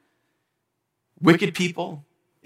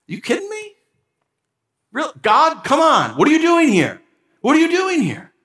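high, indignant cry at God; outraged disbelief; 11 semitones higher than usual. Real, not acted. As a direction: protest the injustice, voice climbing. Outrage at custody ruling.

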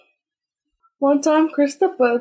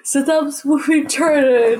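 ordinary talk, nothing marked

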